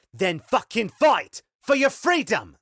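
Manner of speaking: high, loud furious scream. Acted. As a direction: scream it in his face. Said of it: "She screamed at him".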